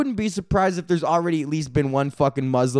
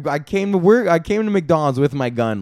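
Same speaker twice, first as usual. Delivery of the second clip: ordinary talk, nothing marked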